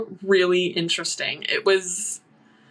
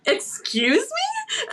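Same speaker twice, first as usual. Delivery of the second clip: very high, drawn-out disbelief; squeaky retold shock; incredulous; much slower than usual. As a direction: stretch it into a high, stunned question. Told that she is dead.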